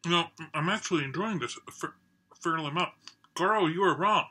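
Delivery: speaking between bites; chewing while talking. Real, not acted. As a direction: mouth partly full of food. Seen 2: Food enters mouth at -7; jaw chewing visible through ~4.7.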